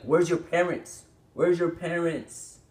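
indignant call to the absent parents; exasperated demand. Real, not acted. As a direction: demand it in drawn-out, high-pitched disbelief. Ranting at absent parents of cursing child.

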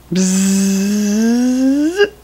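a playful imitated buzz; bee buzz. Parent buzzing like a bee for the child, playful buzz. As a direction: buzz like a busy little bee.

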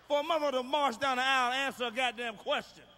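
loud rant. Real, not acted. Comic ranting to the crowd about a twenty thousand dollar wedding, shouted. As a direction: yell it out, outraged at the price.

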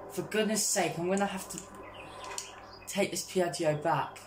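normal talking voice

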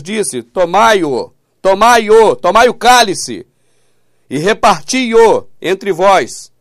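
loud, insistent reading with key verbs stretched out; emphatic scripture reading. Acted. Preacher re-reads for people who didn't understand, loud and emphatic.

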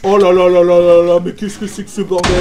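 hammed-up dismay dropped deep into the chest; deep mock lament. Player sees his ruined house, moans in a deep put-on voice.

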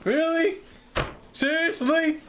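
high, incredulous; mock disbelief. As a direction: act stunned at the question. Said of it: "I'd be like".

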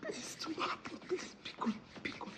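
wife whispering pleas to her husband, terrified. Acted. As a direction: whisper your pleas, too scared to speak up.

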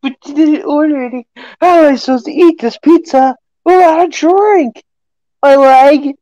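high, loud whiny cartoon complaint; cartoon character voice. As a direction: whine like an upset cartoon customer. Acted Krusty Krab scene.

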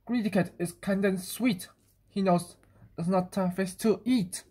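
usual speaking voice

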